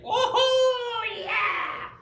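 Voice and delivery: long high-pitched holler of joy; retold gleeful whoop. Boy swaying atop the tree, mock thrilled whoop. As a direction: whoop it out like a thrilled kid, hammed-up.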